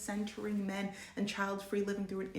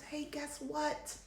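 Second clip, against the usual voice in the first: sarcastic high tease; 8 semitones higher, 3 dB quieter, slower than usual. Real, not acted. Sarcastic reveal.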